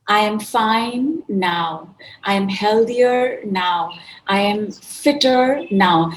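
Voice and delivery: loud, slow "I am fine now" lines; slow affirmations. Shown as a demonstration. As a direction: give each affirmation weight. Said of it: "use the word now".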